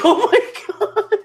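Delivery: high, laughing oh my God; laughing disbelief. Real, not acted. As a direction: crack up in disbelief. Laughing at friend.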